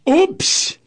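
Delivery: high squeaky Oops!; mock oops. Host reading a fan message, squeaks the Oops!. Playful punchline of a listener message.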